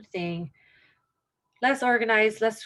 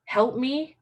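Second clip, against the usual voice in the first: voicing scared person; 3 semitones higher, 4 dB louder, much slower than usual. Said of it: "what was that person trying to say?".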